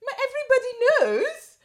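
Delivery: very high, loud French exclaim; French accent. Retold or imitated. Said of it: "it's like".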